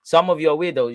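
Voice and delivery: ordinary delivery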